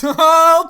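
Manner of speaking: loud, high-pitched "oh"; hammed-up outburst, sudden and high; mock startled yell. Jerry facing the alien condor, mock startled yell.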